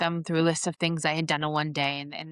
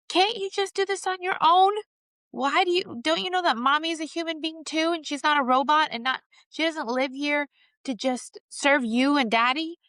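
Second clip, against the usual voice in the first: snapping yell; 10 semitones higher than usual. Retold or imitated. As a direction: snap at the kid, voice pitched way up. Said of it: "But I didn't say it like that".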